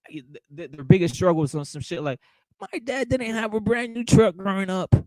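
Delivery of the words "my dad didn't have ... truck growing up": higher mock whine of a spoiled fighter; mocking whiny crybaby. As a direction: whine it to ridicule them. Mocking whiny American boxers.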